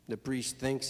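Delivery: ordinary delivery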